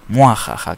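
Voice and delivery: hammed-up villain cackle; evil laugh. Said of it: "qué malvado soy". (risa malvada)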